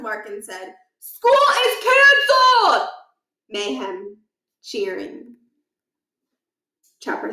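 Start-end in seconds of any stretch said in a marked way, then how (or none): 1.0-2.9 high, loud, slow proclamation; announcing shout. Acted. Principal shouting the cancellation to the students, loud. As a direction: bellow it to everyone.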